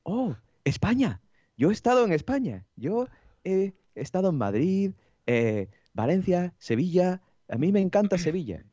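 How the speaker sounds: lightly mock Japanese man speaking Spanish; Japanese tourist. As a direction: play the eager foreign tourist, high and slow. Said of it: "Y me dice el tío".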